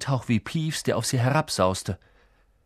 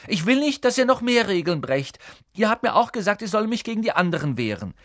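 put-on high-pitched, protesting boy; earnest, upset Neville; 11 semitones higher, 4 dB louder than usual. Neville standing up to his friends, high and insistent. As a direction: plead and protest in a raised pitch.

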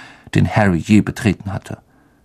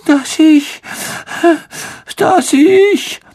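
high, wailing and tearful, slowing down; milk the tragedy; tragic sobbing; 4 dB louder than usual. Acted. Myrte to the three, wailing tearfully about Peeves. Myrte tells her sad death.

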